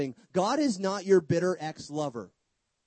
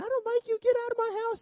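much higher squeaky mock voice; mock angry spouse; faster than usual. Preacher mocking a Trinitarian divorce, high squeaky mock spouse. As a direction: ham up a bickering spouse throwing him out.

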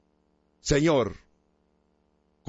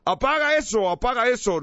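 frightened shout; 7 semitones higher than usual. Acted. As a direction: cave dwellers yelling in alarm to put the fire out.

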